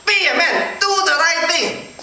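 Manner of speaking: put-on high, drawn-out Chinese-accented urging. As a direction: urge him in a mock Chinese accent. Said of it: "he totally started telling me this thing".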